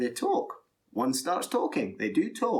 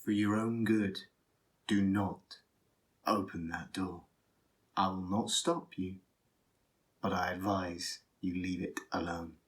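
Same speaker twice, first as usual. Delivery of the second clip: quiet and measured, dropping softer by the end; grave Thane; 4 semitones lower, 4 dB quieter than usual. Acted. Thane warning a storyteller off a forbidden door, grave. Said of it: "And the Thane says".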